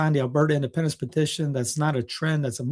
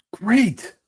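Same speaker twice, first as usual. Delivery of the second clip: excited; 8 semitones higher, 3 dB louder than usual. Real, not acted. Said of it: "Sorry, I'm getting a little excited".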